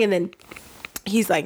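ordinary talk

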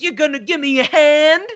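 high, loud mock quip; jokey quip. Host mimics the film's heroine popping up, jokey quip.

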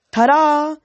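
high, loud, held-out fanfare; showy announcement. A narrator's reading. Leo shows off the folded dragon, showy announcement. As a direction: present it like a stage magician's reveal. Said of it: "he announced".

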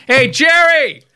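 high-pitched construction worker's holler; imitated yell. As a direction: call out like a fan yelling from a rooftop. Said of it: "rooftops yelling".

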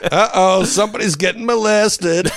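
high, slow, drawn-out sing-out; gleefully inappropriate TV teaser voice; mock promo tagline.

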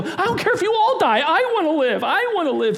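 high, loud selfish whine; selfish fear. Acted. Fear walking into the room, high selfish whine. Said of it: "It'll walk into a room this size and go".

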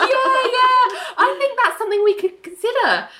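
very high, giddy forced delight, imitating Ali; manic grinning gush. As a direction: say it through a frozen manic grin. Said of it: "smiling maniacally".

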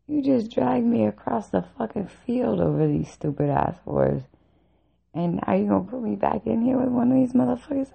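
high-pitched, exasperated disbelief; acted-out outraged rant in a high voice; incredulous protest. Retold protest at return to room.

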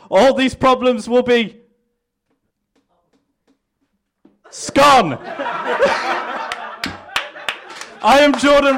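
mock campaign speech. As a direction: sell it like a smug candidate at a rally.

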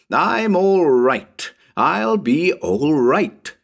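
slow, high, eerie croak; creepy changeling child croaking slowly. Acted. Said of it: "croak in that changeling voice".

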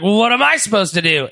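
fast, high-pitched mock complaint; mock whining. Host voicing a wrestler told his partner is out, mock whine. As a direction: imitate a helpless, exasperated wrestler.

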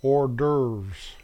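"hors d'oeuvres" as "or-dervs"; American-style "or-dervs" for "hors d'oeuvres"; American pronunciation. Shown as a demonstration. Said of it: "The American pronunciation is".

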